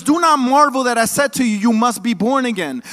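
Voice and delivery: loud scripture proclamation; preaching cadence. Acted. Preacher quoting Jesus to the congregation, proclaiming. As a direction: proclaim it like a pulpit.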